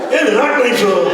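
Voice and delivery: loud, high echo of the reply; mock surprised echo. Swami echoing the speaker's reply, loud mock surprise. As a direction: throw the words back in mock shock. Swami echoes him, much louder.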